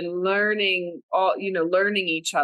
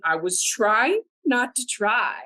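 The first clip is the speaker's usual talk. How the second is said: usual speaking voice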